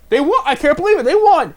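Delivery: high, loud, disbelieving joy; a kid screaming they won; excited kid. Retold or imitated. Remembering the game as a kid, thrilled disbelief. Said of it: "I remember watching that game as a kid and being".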